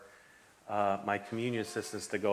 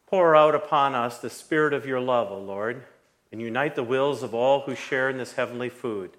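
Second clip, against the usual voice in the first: liturgical prayer; 3 semitones higher, 4 dB louder than usual. Acted. Pastor continues eucharistic prayer.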